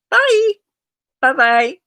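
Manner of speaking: high, loud sing-song bye; cheery goodbye. Real, not acted. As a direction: wave them off brightly. Sign-off to viewers.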